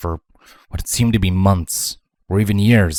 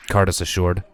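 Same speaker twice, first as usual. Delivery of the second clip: ordinary delivery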